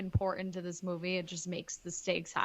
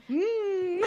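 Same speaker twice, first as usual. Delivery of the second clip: high, louder savoring hum; mock mmm of delight; 12 semitones higher, 5 dB louder than usual. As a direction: hum like tasting first-class ice cream. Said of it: "they're like".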